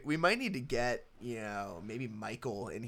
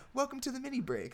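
much higher, quick intro read; high podcast intro; 13 semitones higher, faster than usual. Retold or imitated. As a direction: toss off the intro, pitched way up. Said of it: "we went back to the".

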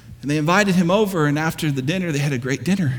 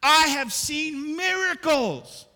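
soaring preaching; 9 semitones higher, 4 dB quieter than usual. Acted. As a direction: lift them up with hushed awe, building. Preaches the promise passage.

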